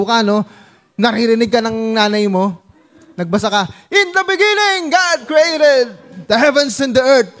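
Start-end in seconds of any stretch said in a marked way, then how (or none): 3.9-7.3 high, booming proclamation of Genesis. Acted. Child reading Genesis aloud so mother jumps up in alarm. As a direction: declaim it like a thunderous preacher.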